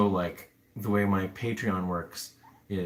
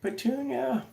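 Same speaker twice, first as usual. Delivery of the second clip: much higher sing-song call of "Shark". Real, not acted. Looking for the guinea pig Shark, high calling voice. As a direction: call the pet out of hiding.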